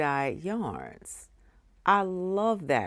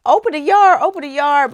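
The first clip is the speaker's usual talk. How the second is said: high, loud chant of impatient viewers; 8 semitones higher, 11 dB louder, much faster than usual. Retold or imitated. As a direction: imitate an audience clamoring. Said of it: "I know you all are saying".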